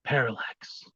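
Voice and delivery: hushed, breathy whispered name; soft dramatic whisper. Acted. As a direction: breathe it out like a eulogy. Dramatic whisper of his persona's name.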